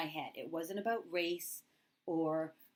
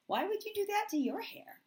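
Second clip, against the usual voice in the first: much higher, teasing echo of the question; playful comeback. Retold or imitated. To her coworker, echoing her words back: high teasing retort. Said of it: "I looked at her and I said".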